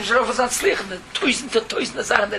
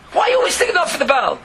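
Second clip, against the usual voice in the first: high, fast, louder demand; questioning lion; 7 semitones higher, 3 dB louder, faster than usual. Acted. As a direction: press him hard.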